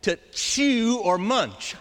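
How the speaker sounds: to chew or munch drawn out slowly, each word weighed; slow and graphic. Shown as a demonstration. Preacher explaining trogon to congregation, slow graphic emphasis. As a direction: savour the crude verbs. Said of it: "what it means is to gnaw".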